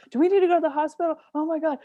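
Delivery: high, fast, panicky mock fear; panicked. Retelling the camper asking the ranger, hammed-up panic. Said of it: "was like".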